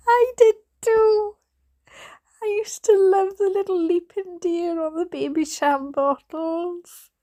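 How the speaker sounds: very high, loud laughing squeal; helpless laughter. Real, not acted. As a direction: squeal it out through real laughter. Reader giggling over her own memory.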